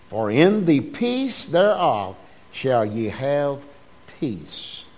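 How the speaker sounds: measured, declaiming King James reading; scripture reading. Acted. Preacher reading Jeremiah aloud to the church, declaiming. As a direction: read the verse with preaching weight.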